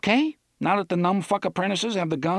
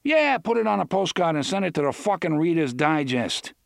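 sarcastic retort; 6 semitones higher, 3 dB louder than usual. Acted.

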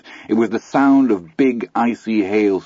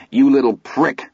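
higher-pitched, raised insult; a mildly raised, pitched-up jab; loudly; 6 semitones higher, 3 dB louder than usual. A narrator's reading. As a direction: fling the insult at him. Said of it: "Eyeball said loudly".

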